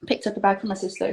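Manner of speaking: ordinary talk, nothing marked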